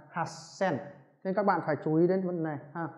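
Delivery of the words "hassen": softer Hasen, held s as in has-sen; doubled s in Hasen. Shown as a demonstration. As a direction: catch the s in Hasen before releasing sen. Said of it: "chữ to này sẽ biến thành chữ".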